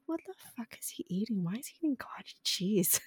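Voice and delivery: hushed, horrified under the breath; shocked whisper. Retold or imitated. Daughter eyeing dad's strange dessert, in a horrified whisper. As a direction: whisper it in disbelief. Inner reaction retold.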